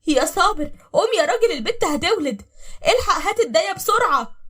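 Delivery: high, loud, panicked yell; frightened shouting. Acted. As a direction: shout urgently to rouse your husband for the midwife. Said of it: "وهي بتزعق بخوف". (زعيق بخوف واستعجال)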